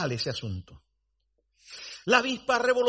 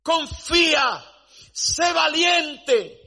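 urgent exhortation; 7 semitones higher, slower than usual. Acted. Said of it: "diciendo el apóstol Pablo a Timoteo".

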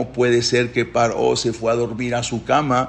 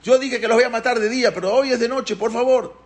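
high, insistent imitated plea; calling out; 10 semitones higher than usual. Paró calling Moshe and Aarón by night, high pleading call. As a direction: call out and beg, pitched high. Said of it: "Y lo llamaba".

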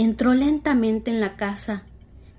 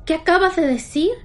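horrified; 7 semitones higher, 4 dB louder than usual. Acted. Horrified maid questions doll.

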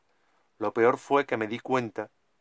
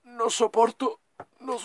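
high, slow, strained last words; dying words; 6 semitones higher than usual. Acted. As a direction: beg slowly with dying breath. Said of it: "mis últimas palabras".

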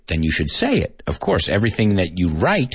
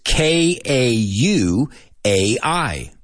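slow, letter by letter; each letter drawn out and separate; spelling out; much slower than usual. Shown as a demonstration. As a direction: spell it out slowly for students. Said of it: "I'm going to spell some words for you".

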